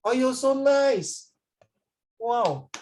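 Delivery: high, slow admiring coo; gushing praise. Real, not acted. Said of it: "this is not sarcastic".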